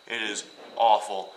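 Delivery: It is awful, with awful hit loud and long; stress on awful. Real, not acted. Telling viewers about the bus ride, awful stressed loud. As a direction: lean hard on awful. Stressing how bad the drive was.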